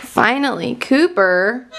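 usual speaking voice

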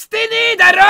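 playful fake outrage; mock angry snap. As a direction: fire it back at the viewer. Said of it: "切れる生配信".

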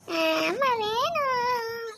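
put-on high, drawn-out child's bawl; crying wail. Daughter cries to father that she wants mother, wailing. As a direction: wail slowly for Mummy. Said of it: "சரி, சரி, அழுவாத".

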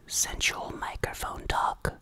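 slow breathy mock-sexy whisper; hammed-up intimate whisper; sensual whisper. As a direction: whisper it like seductive mic talk. Topic: sensual microphone talk.

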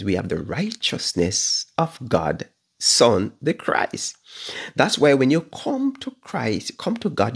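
ordinary talk, nothing marked

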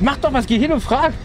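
retold wife's pressing urge, pitched high; wife's urgent plea. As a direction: push him to go and ask, a touch anxious. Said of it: "meine Frau so, so der Blick".

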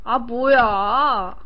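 usual speaking voice

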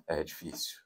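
very soft, low, absent mumble; uninterested reply. Acted. Listener who tuned out answers the talker, barely audible absent murmur. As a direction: toss it off without listening at all. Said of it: "respostas de quem não está te ouvindo".

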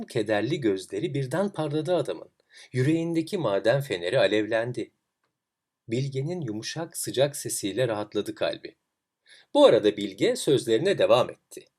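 ordinary delivery, nothing marked

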